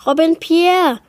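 high, loud panicked cry of a name; mother crying out in fear as her son goes under; panicked scream. A narrator's reading. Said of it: "schrie seine Mutter panisch".

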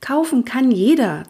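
high, huffy objection; nagging protest. A narrator's reading. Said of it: "zeterte Lotte". (zeternd, hoch)

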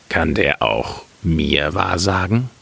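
low, slow miller voice; neugieriger Müller. A narrator's reading. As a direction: ask curiously in a deep, slow character voice. (neugieriger Müller, tief und langsam)

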